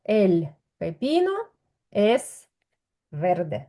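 slow, deliberate teacher model phrase; slow model sentence. Shown as a demonstration. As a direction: spell out each word slowly for the student. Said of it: "я кажу так". (повільно, чітко, як зразок для учня)

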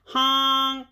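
storybook cry of a stuck truck; mildly scared honk. A narrator's reading. Dump truck stuck in the mud, mildly scared honk. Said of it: "and he sounded scared".

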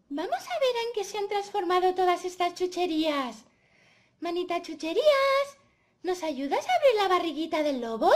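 put-on loud, high little-girl calling; eager Caperucita. Caperucita asking the little hand to open the wolf, eager. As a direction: call out for help with eager energy.

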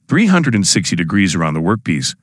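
ordinary talk

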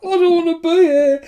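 high, loud squeal through laughter; words breaking up into giggles; helpless laughter. Real, not acted. Laughing fit, jokes about wanting out.

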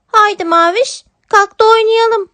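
high, bright little kitten voice calling her sister; a small kitten cheerfully waking her sister. Acted. Kitten character voice. (yavru kedi sesi, neşeli)

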